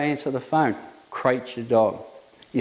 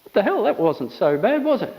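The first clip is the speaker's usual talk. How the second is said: higher, surprised dog voice climbing on was it; voicing the dog; 6 semitones higher than usual. Trainer voicing a relaxed dog after a short crating. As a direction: play the dog, shrugging off the wait. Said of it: "The dog goes".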